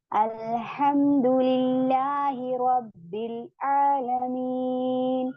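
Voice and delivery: slow, drawn-out Arabic recitation; Quranic recitation. Shown as a demonstration. Teacher recites slowly to show the students. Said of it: "अब देखिए".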